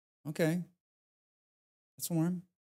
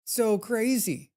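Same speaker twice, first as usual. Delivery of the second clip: higher, slow, amazed repeat; excited; 7 semitones higher, much slower than usual. Real, not acted. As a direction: stretch out so crazy in awe. Raving about Death Taxi addiction.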